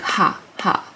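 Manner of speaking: breathy whispered "ha, ha"; just air, no voice, on "ha". Shown as a demonstration. Teacher whispering "ha" to show the breath. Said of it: "if you think about, like".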